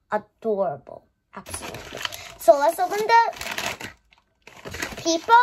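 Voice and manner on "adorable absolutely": soft whispered agreement; hushed aside. Real, not acted. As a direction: breathe it under your voice. Admiring accessory.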